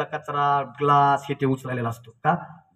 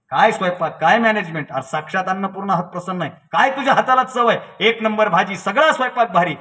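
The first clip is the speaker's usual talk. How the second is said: raised, lively admiration; effusive praise; 4 semitones higher than usual. Retold or imitated. Father praising the cook for the wedding food, gushing. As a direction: gush over each dish in short bursts.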